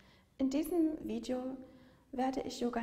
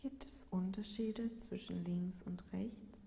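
calm yoga nidra voice, long unhurried pauses; guided meditation; 2 semitones lower, 4 dB quieter than usual. Real, not acted. Yoga teacher guiding listeners lying in Shavasana, calm meditative delivery. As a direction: lead a body scan gently, leaving space after each phrase.

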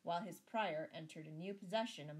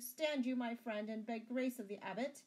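higher, louder pleading; begging; 6 semitones higher, 6 dB louder than usual. A narrator's reading. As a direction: beg earnestly for a favour. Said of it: "begged Sir Richard".

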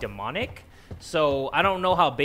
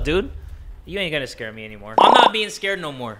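ordinary talk

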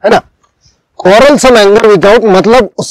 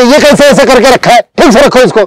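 higher mock scolding of the husband; a wife scolding her husband, higher and in mock; 6 semitones higher than usual. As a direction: nag him sharply. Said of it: "ऐसे मुझे बोलती है".

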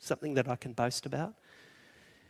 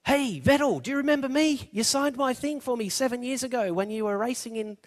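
an excited, higher-pitched shout; screaming out; 9 semitones higher than usual. Retold or imitated. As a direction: yell up to the driver, desperate to be remembered. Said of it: "screaming out".

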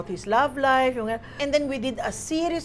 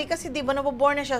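usual speaking voice

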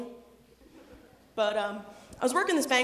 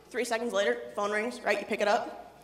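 usual speaking voice

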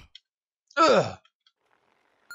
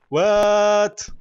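shocked shout; 6 semitones higher, 6 dB louder than usual. Real, not acted.

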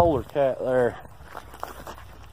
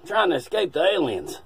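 put-on old man agent voice; 5 semitones higher, 6 dB louder than usual. Agent escaping aliens.

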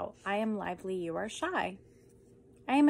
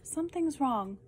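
alarmed higher; 5 semitones higher than usual. Acted. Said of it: "repeated line, higher".

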